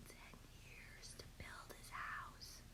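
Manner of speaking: hushed whispered aside. Real, not acted. Teacher whispering an aside to the kids. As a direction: lean in and whisper it. Aside to class while reading.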